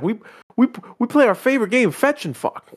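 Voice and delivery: high, giddy dog voice; Will Ferrell's dog voice. Retold or imitated. Will Ferrell's dog gushing about fetch, high giddy voice. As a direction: bounce it up high like a happy dog. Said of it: "Will Ferrell's like".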